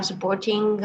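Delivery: ordinary talk, nothing marked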